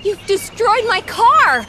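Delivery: loud, high, slow accusation; angry outburst. Acted. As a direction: draw out the outraged accusation loudly. Woman furious over her wrecked car.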